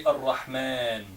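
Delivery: "Ar-Rahman" drawn out slowly, Arabic a. Shown as a demonstration. Teacher slowly models "Ar-Rahman" for the class. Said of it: "When you say".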